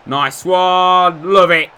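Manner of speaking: loud, high cheer for a goal; celebratory shout. Real, not acted. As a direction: shout it out in delight.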